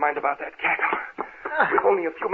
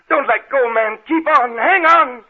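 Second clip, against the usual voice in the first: higher and louder as panic builds; desperate urging; 10 semitones higher, 5 dB louder, slower than usual. Acted. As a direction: beg him not to give up, climbing higher. Urging fainting servant to hold on.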